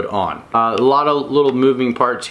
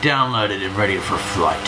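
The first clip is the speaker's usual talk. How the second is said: pirate voice; 5 semitones lower than usual. Pretend. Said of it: "Enough with the pirate voice".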